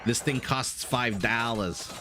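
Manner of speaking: slow imitation of a New York accent; drawn-out New York "dollars" with no r. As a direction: mimic New York relatives, "dollars" as dah-luhs. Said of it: "My American family in New York".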